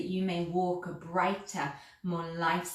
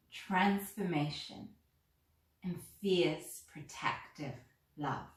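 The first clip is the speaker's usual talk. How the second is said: guided meditation. Acted. Guiding listeners to invoke Kali, calm meditation voice. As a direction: ease the listener inward, unhurried and gentle.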